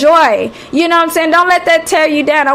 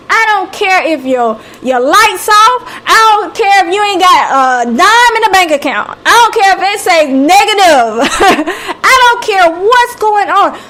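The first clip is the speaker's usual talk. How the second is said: preaching; 4 semitones higher than usual. Acted. Speaker urging viewers not to give up, preaching. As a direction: lift them up like a preacher.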